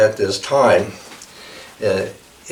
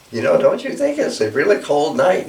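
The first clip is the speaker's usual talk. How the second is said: higher, pleading bird voice; mother eagle asking; 7 semitones higher than usual. Acted. Mother Eagle asks the stag, higher and pleading. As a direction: plead with the old stag, pitched up.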